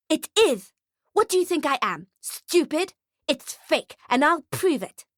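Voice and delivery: put-on high, accusing child voice; high-pitched scornful brat; bratty little girl, defiant. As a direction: argue like a know-it-all kid.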